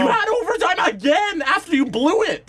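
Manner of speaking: very high-pitched, heated arguing; exasperated rant. Real, not acted. Arguing the team blew a second chance, exasperated. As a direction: let the frustration climb right up in pitch. Heated rebuttal in hockey argument.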